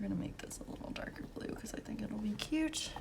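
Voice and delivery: whispered plan while painting; hushed note to herself. Real, not acted. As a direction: murmur it as a private whisper.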